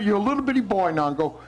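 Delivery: ordinary talk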